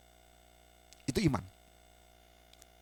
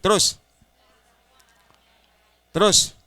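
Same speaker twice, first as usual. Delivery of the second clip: 1 loud prompt; 5 semitones higher, 7 dB louder, slower than usual. Real, not acted. Prompting congregation to continue.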